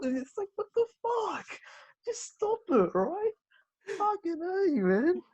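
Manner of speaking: high, worked-up disbelief; exasperated outburst. Real, not acted. Venting to the co-host about bad acting, high and exasperated. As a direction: vent in high frustration.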